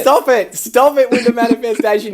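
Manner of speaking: mock scolding. Mock scolding the manifestation idea.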